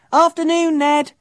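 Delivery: high, friendly hail; calling out. A narrator's reading. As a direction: call a greeting from down the street. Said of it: "Fergus called".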